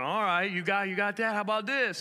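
preacher upping it. Retold or imitated. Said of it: "I'm going".

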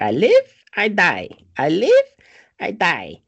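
imitating a weird, loud, high chant; mock-eerie outbursts, each line punched out; weird villager woman. Retelling a girl's weird moment, chanted loud and high. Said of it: "weird moment where she starts saying".

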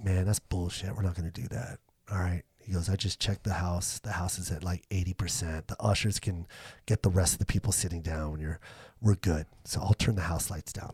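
low, soft, laid-back stage manager; low-key reassurance. Retold or imitated. Stage manager taking the comic's side, low-key reassurance. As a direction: keep it quiet and matter-of-fact.

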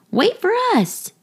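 high yell to wait. A narrator's reading. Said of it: "they yelled".

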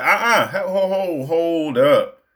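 high, drawn-out comic protest; acted objection. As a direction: halt a food thief in mock alarm, stretching it out. Acted objection to fry thief.

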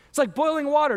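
ordinary delivery

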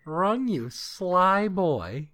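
high, slow teasing coo; high, drawn-out teasing voice; teasing sly boy. Retold or imitated. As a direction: coo it at a sneaky little boy. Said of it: "And you're just like".

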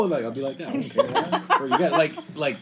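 ordinary talk

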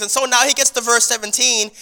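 ordinary talk, nothing marked

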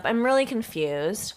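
usual speaking voice

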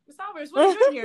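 lightly voiced principal calling her out in surprise; surprised principal.

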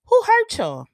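high, loud exasperated cry; animated outburst. Real, not acted. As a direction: fling the question at them, fed up. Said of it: "This is where I get animated at".

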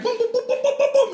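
high rapid-fire "boom" noises; imitated explosions. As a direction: mimic a volley of explosions excitedly.

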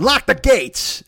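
high, slow drawn-out cry; hammed-up call to lock the gates; mock alarm call. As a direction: sound the alarm in jest.

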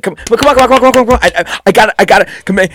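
high, loud, rapid-fire urging; frantically impatient customer. Acted. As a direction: hurry them along in a frantic high voice. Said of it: "customers that are just extremely like".

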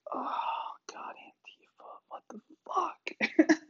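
slow, breathy whisper of disbelief; disgusted whisper. Pretend. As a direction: whisper it like God cringing at his creation. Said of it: "and he's just like".

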